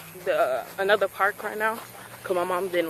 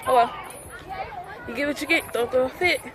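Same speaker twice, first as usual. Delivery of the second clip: talking with tongue stuck out; tongue sticking out; 3 dB quieter than usual. Real, not acted. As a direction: say it with your tongue out. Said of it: "Sorry, I keep sticking my trunk out".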